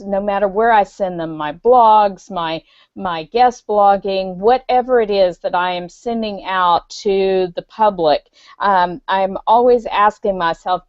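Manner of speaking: ordinary talk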